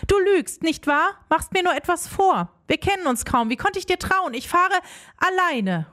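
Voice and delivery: high-pitched, mildly heated accusation; accusing call. A narrator's reading. Rosalie confronts Angelo on his stairs, calling out accusingly. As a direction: call out the reproach without much extra volume. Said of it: "rufe ich".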